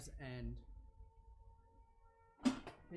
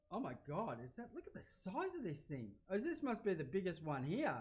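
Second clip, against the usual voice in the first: ordinary talk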